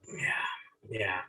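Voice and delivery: hushed yeah, yeah under the breath; whispered aside. Acted. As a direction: whisper it like a guilty secret.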